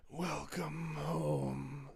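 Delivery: drawn-out soft creature growl; monster voice. Acted. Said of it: "You want me to do the monster voice?".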